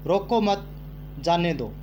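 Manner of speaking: रोको मत run together, a break, then जाने दो; group it as रोको मत, pause, जाने दो falling; pause after मत. Shown as a demonstration. Teacher showing pupils how punctuation changes meaning, pause after मत. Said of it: "देखो यहाँ एक विराम चिन्ह की त्रुटि के कारण".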